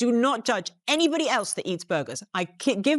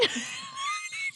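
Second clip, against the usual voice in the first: shrieking laugh; 9 dB quieter than usual. Real, not acted. Bursts laughing at own impression.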